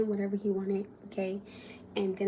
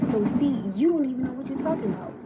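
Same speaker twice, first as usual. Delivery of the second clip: angry retort; 4 semitones higher, 6 dB louder than usual. Retold or imitated. Angry retort in retold argument.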